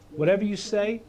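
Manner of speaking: WHATEVER YOU SAY lifted high as a refrain; repeated refrain. Shown as a demonstration. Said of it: "take something and you repeat it".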